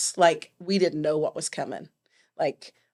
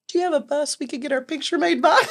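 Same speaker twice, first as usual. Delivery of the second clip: asked higher through a laugh; 7 semitones higher than usual. Retold or imitated. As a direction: laugh your way through the cheeky question. Cheeky question to candidate, laughing.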